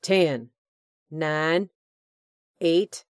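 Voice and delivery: loud taunting countdown; menacing countdown. Acted. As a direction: count down like a threat. Hugh counting down on radio.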